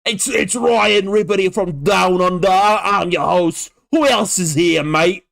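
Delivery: broad put-on Aussie co-host; Australian accent. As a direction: introduce yourself in a hammy Australian accent. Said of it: "can't do an Australian accent".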